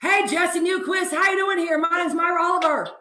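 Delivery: big hollered Texas greeting; Myra Oliver. Acted. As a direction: put on a loud, high Texan woman's voice. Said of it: "she's gonna do an impression for you".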